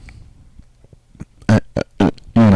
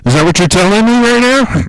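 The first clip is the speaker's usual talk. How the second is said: high incredulous questions climbing higher; mock outrage; 10 semitones higher than usual. Taunting the FBI, climbing mock-incredulous questions. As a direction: ham up the disbelief, rising to a peak.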